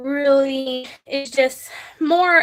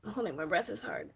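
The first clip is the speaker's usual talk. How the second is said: guided breathing; 2 semitones lower than usual. Acted. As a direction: guide viewers through each breath, unhurried. Leads a breathing exercise.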